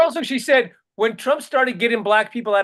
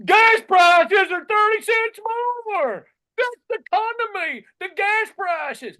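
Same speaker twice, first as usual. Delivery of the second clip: mocking Trump supporter; 10 semitones higher, slower than usual. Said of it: "they go".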